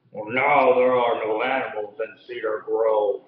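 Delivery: gentle hint of a menacing giant. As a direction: rumble it out as a big looming giant. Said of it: "said the giant".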